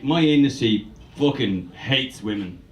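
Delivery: ordinary talk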